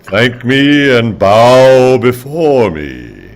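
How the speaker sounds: very slow, commanding god voice. Pretend. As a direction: demand worship like a deity. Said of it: "That God?".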